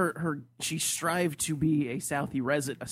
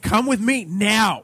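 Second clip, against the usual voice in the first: loud, higher, drawn-out order; mock angry command; 6 semitones higher, 10 dB louder, slower than usual. Friend imitates host grabbing his fiancee, exaggerated angry command. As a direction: imitate a man angrily ordering someone along. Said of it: "you did angrily grab her".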